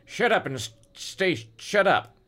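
high-pitched menacing growl; growled order. A narrator's reading. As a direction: growl it as a thuggish gunman. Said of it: "he growled".

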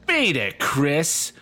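loud, high, raspy dummy snarl; harsh raspy snarl. Acted. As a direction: snarl it like an evil puppet. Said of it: "he snarled in a harsh, raspy voice".

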